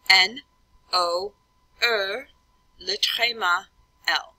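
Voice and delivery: French letter names, one by one with pauses; careful, spaced French dictation; French spelling aloud. Shown as a demonstration. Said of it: "you say the letter and then".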